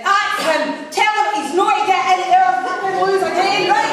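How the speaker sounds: high, loud indignant yell; mock angry shout. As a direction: shriek it like an outraged old lady.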